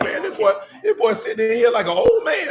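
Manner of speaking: high, loud mock disbelief; mock exclamation. Preacher retelling his reaction to a boy, high loud disbelief. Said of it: "I'm like".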